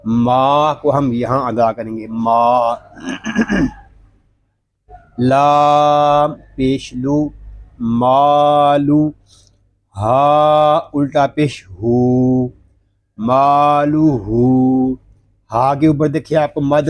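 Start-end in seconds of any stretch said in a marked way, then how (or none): 2.1-2.8 "माँ" said as an open maa, nothing in the nose; non-nasal vowel. Shown as a demonstration. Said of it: "जिस तरह माल कलिमा है". 5.2-15.0 slow, drawn-out letter-by-letter recitation; Quran spelling drill. Shown as a demonstration.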